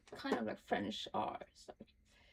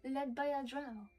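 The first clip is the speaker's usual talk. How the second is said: reading a journal entry aloud, a little higher, measured; diary reading; 3 semitones higher, 4 dB quieter than usual. Acted. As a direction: read it like a private diary, steady and serious. Reads Halsin's diary aloud.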